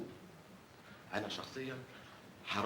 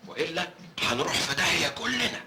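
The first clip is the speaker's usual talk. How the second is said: urgent warning; 6 semitones higher, 6 dB louder than usual. Acted. Warning the gang of danger.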